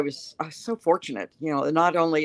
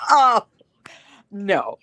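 higher and louder startled oh no; surprised denial; 4 semitones higher, 6 dB louder than usual. Retold or imitated. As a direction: blurt it out, taken aback. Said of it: "And I said".

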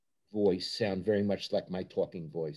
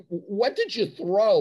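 slow, raised, prying reporter; pushy reporter question; 9 semitones higher, 7 dB louder, slower than usual. Retold or imitated. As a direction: needle him like a clueless reporter. Reporter pestering a player after a loss.

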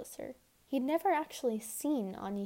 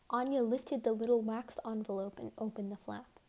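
usual speaking voice

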